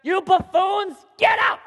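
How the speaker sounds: high, loud angry bellow; insulting yell. Acted. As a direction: hurl the insult and throw them out. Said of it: "yelled at them, insulted them".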